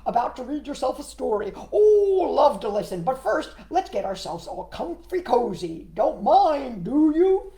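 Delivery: a frog inviting himself in; eager frog voice. Acted. Froggy voice continues.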